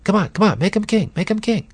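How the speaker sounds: high, coaxing tempter voice; a sly devil urging the crowd on; Satan egging on the crowd. Retold or imitated. Preacher voicing Satan urging the crowd, high and coaxing. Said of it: "I can almost hear Satan in the crowd going".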